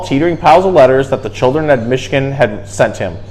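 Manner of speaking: ordinary talk, nothing marked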